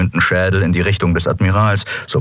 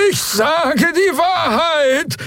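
very high, slow wailing shout; desperate Draxyl; much slower than usual. Acted. Prisoner insisting on his truthfulness, desperate. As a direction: wail it out in a high alien voice.

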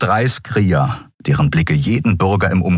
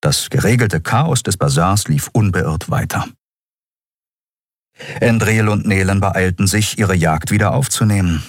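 ordinary delivery

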